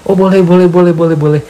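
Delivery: high, loud, gushing repeated agreement; overeager yes. Retold or imitated. Eagerly agreeing, cannot refuse. (setuju dengan sangat antusias, nada tinggi dan keras)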